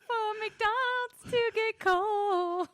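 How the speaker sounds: mock whiny voice. Joins the mocking, high and slow.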